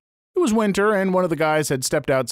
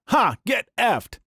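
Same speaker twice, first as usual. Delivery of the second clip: retold yell; 6 semitones higher, slower than usual.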